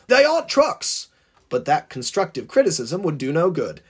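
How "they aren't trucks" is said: high-pitched shouted rebuke; calling out. A narrator's reading. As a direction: call out in high protest. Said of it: "called the driver".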